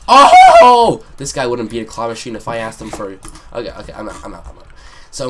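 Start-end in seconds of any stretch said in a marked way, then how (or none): 0.1-1.0 very high, loud "oh" shriek; sudden scream. Real, not acted. Player reacting to the game, sudden loud scream. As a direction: shriek a long, piercing oh.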